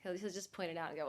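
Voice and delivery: usual speaking voice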